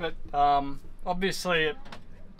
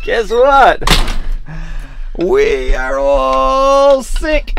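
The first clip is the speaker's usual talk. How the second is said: dramatic announcement; 11 semitones higher, 9 dB louder, much slower than usual. Real, not acted. Announcing bad news theatrically.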